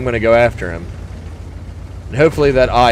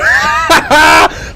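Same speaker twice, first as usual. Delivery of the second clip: loud breathy laugh of glee; triumphant laugh; 11 semitones higher, 5 dB louder than usual. Real, not acted. Gamer laughing loudly after ammo-racking a tank.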